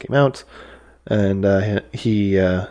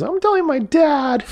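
very high mock kid voice; squeaky, drawn-up playground threat; whiny fleeing bully. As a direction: imitate a kid tattling as he runs off. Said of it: "The, the other bully runs away".

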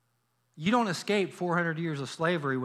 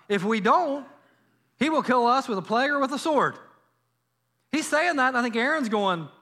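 full force preaching; 6 semitones higher, 4 dB louder than usual. Acted.

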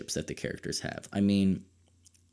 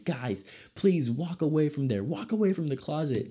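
pleading at the TV; 8 semitones higher than usual. Retold or imitated. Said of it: "you're screaming at the television going".

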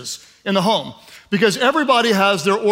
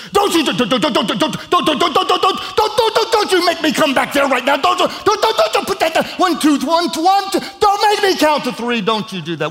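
scolding parent; 8 semitones higher than usual. Retold or imitated. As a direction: rattle off the don'ts like a parent losing patience. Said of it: "we do this with our kids".

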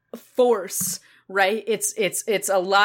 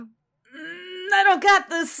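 struggling creature; 6 semitones higher than usual. Retold or imitated. Dust devil struggling.

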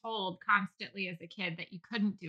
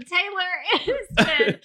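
laughing; 8 semitones higher, 6 dB louder, slower than usual. Real, not acted. Laughing at deductible joke.

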